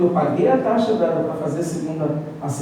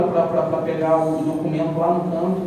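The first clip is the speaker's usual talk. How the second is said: ordinary delivery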